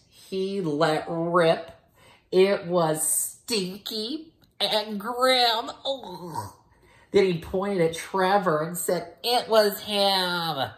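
put-on milk the gross moment for the kids; hammed-up storytelling. Teacher reading the stinky part, slow hammed-up voice. Dramatic reading of the fart scene.

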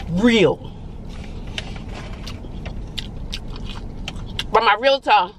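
talking between bites; chewing while talking. Real, not acted. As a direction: mouth partly full. Seen 0: Fry brought to mouth at -1.0, fingers at lips until 0.8.

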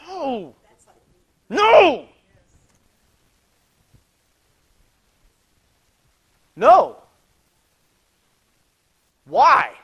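loud, high anguished cries of no; inner shout. Retold or imitated. Preacher reliving his silent protest at the bedside, shouting. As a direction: cry out the protest at full voice. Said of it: "I'm on the inside going".

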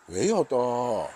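slow, drawn-out grumbling answer; a weary mock-annoyed reply; grumpy reply. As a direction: drag it out like you're being pestered again. Answers imaginary caller's question.

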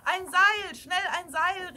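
high, loud emergency call; urgent shout. A narrator's reading. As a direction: shout for help to be fetched. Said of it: "rief jemand".

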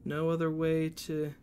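usual speaking voice

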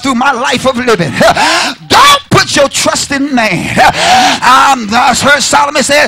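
preaching at full force. Acted. Pastor preaching to the congregation, rising to a shout.

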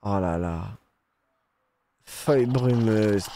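ordinary delivery

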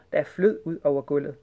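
usual speaking voice

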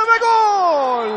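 sudden loud goal shout; screaming football commentator. Real, not acted. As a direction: burst out with the goal. Goal call in live commentary.

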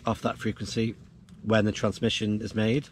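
ordinary delivery

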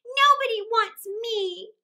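put-on high, slow, loud little-boy wail; nearly crying. A narrator's reading. Left-out little brother nearly cries, high slow plaintive wail. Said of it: "Michael had nearly cried".

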